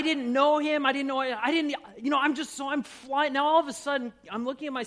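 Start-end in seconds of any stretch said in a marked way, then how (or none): none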